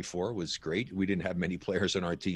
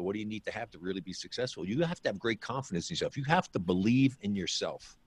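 usual speaking voice

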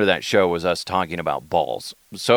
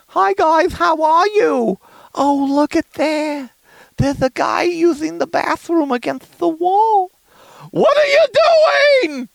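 Mama DeHuff; 5 dB louder than usual. Acted. Mama catches a guy at the wall, high-pitched scolding. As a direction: squeal it as a mama, building to a shriek. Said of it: "You got Mama DeHuff, which I ripped off".

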